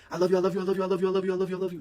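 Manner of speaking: high, loud overjoyed scream of love; joyful screaming. Retold or imitated. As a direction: scream it over and over like an excited dog. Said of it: "and she screams".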